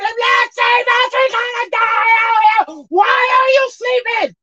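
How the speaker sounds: high, slow, crying shout for help; panicked wailing cry. Retold or imitated. Terrified disciples waking Jesus, high crying slow shout. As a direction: wail at him as the boat goes down.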